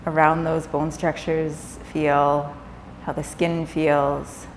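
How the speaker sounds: calm yoga nidra guidance with long pauses; guided meditation. Acted. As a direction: ease them gently into rest, leaving space between phrases.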